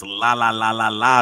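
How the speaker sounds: la-la-la of someone refusing to hear; mock not listening. Podcaster imitating folks who won't listen, mock la-la chant. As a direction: imitate people drowning out what they're told.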